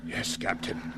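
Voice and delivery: whispered and spent after the fight; breathless whisper. Acted. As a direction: barely get it out, drained. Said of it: "you all right?".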